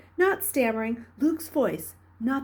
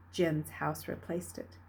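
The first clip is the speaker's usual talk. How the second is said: ordinary delivery, nothing marked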